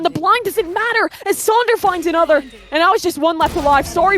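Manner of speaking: fast, rising play-by-play call; esports caster. Acted. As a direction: build the hype through the round. Live play-by-play casting.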